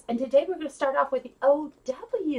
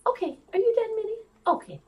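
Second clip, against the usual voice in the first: ordinary talk, nothing marked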